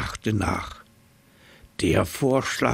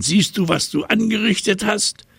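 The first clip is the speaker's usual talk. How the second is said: high, raised reproach; angry scolding; 11 semitones higher than usual. A narrator's reading. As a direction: scold the monkey, high and sharp. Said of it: "schnaubte das Nilpferd den Affen an".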